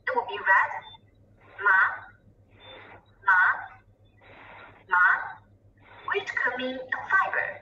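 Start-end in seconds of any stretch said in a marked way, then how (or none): 1.6-5.0 "ma" in a rising Mandarin tone; second tone. Shown as a demonstration. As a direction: let each "ma" climb in pitch. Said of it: "which is a rising tone".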